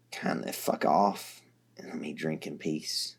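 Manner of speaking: tired, matter-of-fact; weary Dirk. A narrator's reading. Dirk, telling them about Porum, low and weary.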